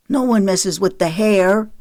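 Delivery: touchy, put-out objection; grumbled complaint. A narrator's reading. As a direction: grumble in protest about being mussed. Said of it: "Mason grumbled".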